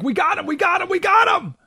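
high, shouted mock triumph; mock hysteria. The left thinking they finally caught Trump, mock hysterical shout. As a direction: ham up a frantic gloating chant. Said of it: "The hysteria, like".

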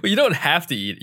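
normal talking voice

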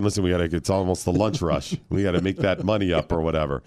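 low, lightly acted inner voice; secret thought. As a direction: drop it low, as if thinking privately. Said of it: "secretly, I'd be thinking".

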